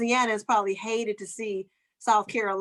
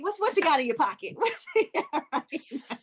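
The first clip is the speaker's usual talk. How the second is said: laughing mock shakedown; 6 semitones higher than usual. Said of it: "it's like".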